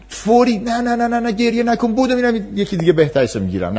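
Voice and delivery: put-on high, hurried parent hushing a crying child; anxious parent, no no no, don't cry; panicky parent. Parent rushing to stop crying.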